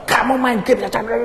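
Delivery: high-pitched parent telling off; mock angry scolding. Parent scolding the child over gaming, mock angry. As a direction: imitate a cross parent rattling off a scolding.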